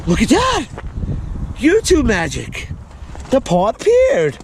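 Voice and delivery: high, excited showman reveal; mock amazement at the magic trick; kids party magician. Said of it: "besides the cheesy joke".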